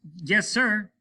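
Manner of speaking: officer obeying promptly; eager reply. Acted. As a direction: answer at once. Martin obeys.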